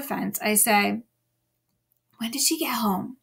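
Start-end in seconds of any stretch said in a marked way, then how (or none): 2.2-3.2 breathy secret whisper, no voice. Retold or imitated. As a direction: whisper so she won't hear. Hiding behind fence, asking secretly.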